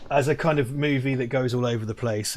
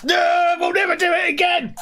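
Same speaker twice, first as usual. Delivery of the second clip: high, loud hammed-up wail; mock drunken shout; 5 dB louder than usual. Imitating pub crowd.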